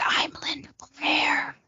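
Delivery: creepy whispered croak, slow. Retold or imitated. Mother recalls daughter taught to creep people out, creepy whisper. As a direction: stretch it out in an eerie, soft whisper. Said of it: "real creepily and be like".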